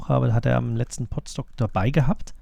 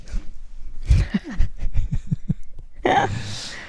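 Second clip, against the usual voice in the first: light amused laugh; laughter; 13 semitones higher than usual. Real, not acted. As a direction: laugh along freely. Laughing at podcast joke.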